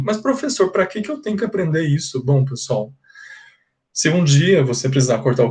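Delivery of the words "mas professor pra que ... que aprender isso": higher, quick whining student question; imitating a student. Teacher voicing a doubting student's question. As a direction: play a doubting pupil asking why. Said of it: "you might think".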